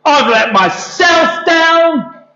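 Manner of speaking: slow, high self-reproaching wail; fed up. Acted. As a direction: draw it out as a big lament. Peter laments in acted story.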